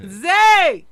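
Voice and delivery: high, loud call of a name; real yelling. Real, not acted. Host calling Zay in the studio, yelling. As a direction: holler the name long and loud. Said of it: "I just like yelling".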